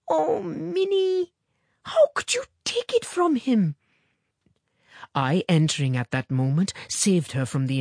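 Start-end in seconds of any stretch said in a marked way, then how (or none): 0.1-3.8 very high, dismayed mother's wail; shocked cry. A narrator's reading. Shocked mother reproaching Minnie over the coin, high cry. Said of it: "cried her mother, much shocked".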